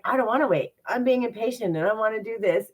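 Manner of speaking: a hint of a spoiled child; impatient whine. Complaining to God, impatient whine. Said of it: "those are things where it's like".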